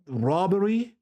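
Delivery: normal talking voice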